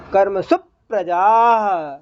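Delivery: कर्मसु प्रजाः with the half प joined onto सु, slowly; slow recitation teaching the half letter; su joined to pra. Shown as a demonstration. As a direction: say कर्मसुप् रजाः, the प leaning on सु. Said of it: "आधे अक्षर को पा, सु के साथ पढ़ेंगे".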